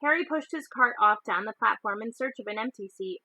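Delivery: plain reading voice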